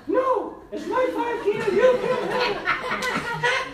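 very high, drawn-out mock girly whine; protesting girl. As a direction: squeal like a child clinging to her money. Voices the robbed daughter refusing.

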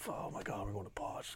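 hushed, nervous whisper; breathless whisper of nervous kids. Retold or imitated. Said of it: "they're all freaking out, nervous".

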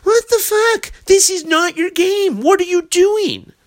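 high, strained cry of disbelief; exasperated disbelief. Retold or imitated. Hockey fan yelling at the Flames, high exasperated disbelief. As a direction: throw up your hands, voice cracking high. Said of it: "like, saying".

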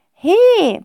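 high, loud protest. A narrator's reading. Cassandra protesting to her mother, indignant. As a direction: object sharply. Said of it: "protestiert Cassandra".

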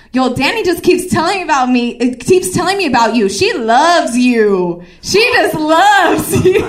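loud, teasing imitation of a friend; hammed-up loud announcement, building; blurting friend. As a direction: draw out "loves" in a gleeful yell. Friend blurts out secret in car.

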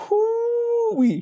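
very high, drawn-out whoop; admiring whoop. Retold or imitated. Storyteller describing the cute girl, admiring whoop. Exclaims over how cute she was.

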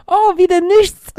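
hohe, langsame, enttäuschte Kinderstimme. Retold or imitated. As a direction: wie ein enttäuschtes Kind jammern. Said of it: "Und die Kinder dann so". (enttäuschte Kinderstimme)

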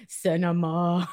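a teasing echo of the co-host's hushed "cinema"; mock dramatic cinema. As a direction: savor the word like a film snob. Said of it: "I like you're like".

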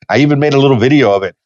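ordinary talk, nothing marked

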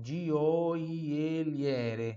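gioielliere said slowly, every vowel clear; Italian word gioielliere. Shown as a demonstration. Teacher modelling gioielliere for students. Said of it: "Tem várias vogais aqui".

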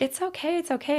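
soft, high, gentle reassurance; soothing. Retold or imitated. Soothing a client fighting tears.

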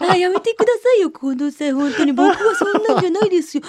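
high mock protest from the colleague, laughing; high, laughing impression of a colleague brushing off teasing; imitating Fujita. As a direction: squeak out a flustered denial in his voice. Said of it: "みたいなのが".

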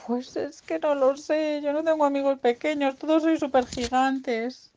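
high, whiny creature voice; acted childlike self-pity; forlorn piglet. Sad piglet has no small friends.

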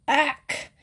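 high-pitched choked "ack"; choking gag. A narrator's reading. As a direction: gag on a foul sip. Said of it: "clutching her throat".

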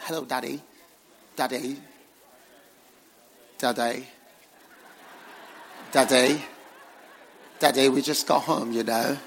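mock British accent, a child calling Daddy; nice British accent. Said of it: "speaking to me nice British accent".

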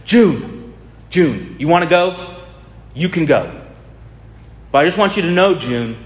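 high, hammed-up movie-star plea; overacting Tom Cruise. Preacher mimics Tom Cruise pleading with June, hammed up and high. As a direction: ham it up like an overacting action hero. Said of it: "in his overacting".

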